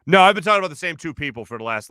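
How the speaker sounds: ordinary talk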